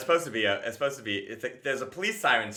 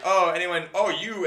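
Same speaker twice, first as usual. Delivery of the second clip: cocky crowd callout; 6 semitones higher, 5 dB louder, slower than usual. Retold or imitated.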